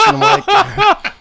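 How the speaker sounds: high, slow words through laughter; can't stop laughing. Real, not acted. Still laughing.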